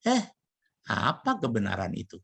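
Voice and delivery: a scoffing brush-off question; mocking, cynical. Shown as a demonstration. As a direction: toss it off with a sneer. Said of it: "pertanyaan yang mengejek, sinis". (nada mengejek dan sinis)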